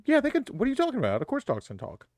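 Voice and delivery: fast, high hammed-up certainty; mock insistence. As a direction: overplay being sure dogs talk.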